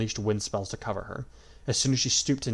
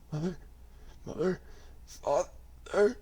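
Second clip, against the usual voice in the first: weak call; 9 semitones higher, 4 dB quieter, slower than usual. A narrator's reading. Blinded dying son calls.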